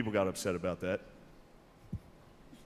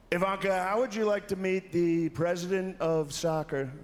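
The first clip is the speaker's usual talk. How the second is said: slow, higher Trump voice; Trump impression; 8 semitones higher, slower than usual. Trump telling his daughter, in a slow Trump voice. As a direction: drawl it out like Trump. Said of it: "His Trump impression is actually really good".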